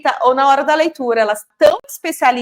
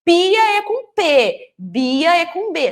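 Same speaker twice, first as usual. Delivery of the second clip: slow, higher, spelled-out delivery; mock teacher dictation; 6 semitones higher, slower than usual. Teacher imitating adult stuck repeating to child, slow singsong. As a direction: over-clearly point out each first letter.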